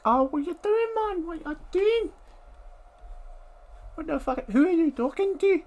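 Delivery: high, loud squeaky mock onlooker. Passerby asking why he talks to the dog, high and loud. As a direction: pitch way up as a baffled passerby. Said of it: "What's with the Scottish accent?".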